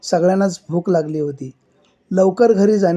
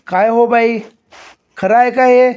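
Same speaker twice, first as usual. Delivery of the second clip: raised, louder sarpanch voice; loud questioning; 5 semitones higher, 4 dB louder than usual. Acted. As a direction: call out the question sharply. Sarpanch loudly questions woman.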